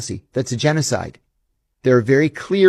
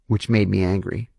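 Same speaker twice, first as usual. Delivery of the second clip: ordinary talk, nothing marked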